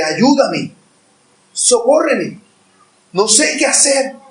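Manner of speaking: a touch of desperate sobbing prayer; tearful plea. Pretend. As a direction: beg for help as if near tears, mildly acted.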